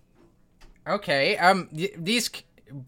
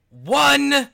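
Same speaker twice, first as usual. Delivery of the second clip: "one" punched higher and louder; stress on one; 13 semitones higher, 14 dB louder than usual. Acted. Chef stressing "one" piece of vinegar, punched word. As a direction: hit "one" hard. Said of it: "There is exactly".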